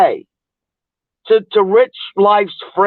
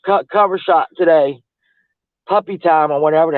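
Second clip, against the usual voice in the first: ordinary delivery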